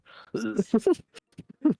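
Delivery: high laugh. Real, not acted. Laughing after the spin.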